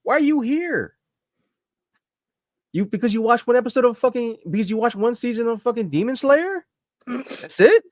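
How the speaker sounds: high, heated mock telling-off; indignant retort. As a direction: call out a fake fan. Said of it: "It's like".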